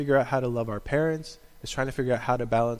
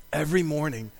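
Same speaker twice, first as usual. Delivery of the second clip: ordinary talk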